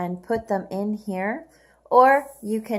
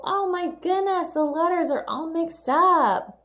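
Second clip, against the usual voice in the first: higher, playful mock amazement; mock surprise; 9 semitones higher than usual. Teacher hamming up surprise at the scrambled letters. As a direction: pretend to be shocked for the kids.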